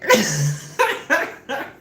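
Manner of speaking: squealing laugh; high laugh. Real, not acted. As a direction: crack up at the line. Laughing at the scene.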